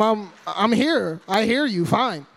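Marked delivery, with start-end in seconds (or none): none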